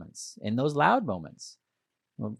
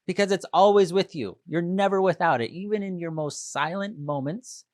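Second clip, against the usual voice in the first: normal talking voice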